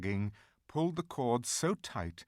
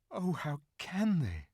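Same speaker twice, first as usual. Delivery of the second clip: tearful; 8 semitones higher than usual. A narrator's reading. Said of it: "tears streaming down her cheeks".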